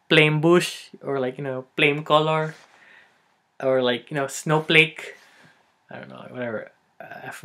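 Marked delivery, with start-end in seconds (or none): 0.1-0.9 "flame bush" said loudly as "plame bush"; Filipino-accented "flame bush", P for F. Shown as a demonstration. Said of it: "There's no F sound". 1.8-2.6 "flame color" said as "plame color", louder; Filipino-accented "flame color", P for F. Shown as a demonstration. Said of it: "There's no F sound". 4.3-5.2 "snowflake" said loudly as "snowplake"; Filipino-accented "snowflake", as "snowplake". Shown as a demonstration. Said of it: "There's no F sound".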